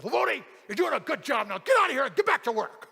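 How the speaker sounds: loud, high barked praise, building to an order; gruff foreman shout. Retold or imitated. Foreman sending worker back to work, shouted. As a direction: imitate a boss hollering at staff.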